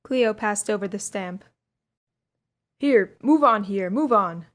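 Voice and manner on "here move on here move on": louder, slow gruff command; gruff policeman. A narrator's reading. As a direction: order the crowd to move on. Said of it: "These words came gruffly from a policeman".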